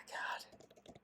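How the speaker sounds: breathy whispered god; exhausted whispered groan; whispered sigh. Real, not acted. Sighing at his own life.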